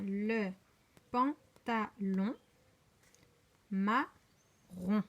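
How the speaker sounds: slow "pantalon" and "marron", every syllable apart; deliberate gaps inside "pantalon" and "marron". Shown as a demonstration. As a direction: read it in separate beats: pan, ta, lon, ma, ron. Teacher rereads syllable by syllable.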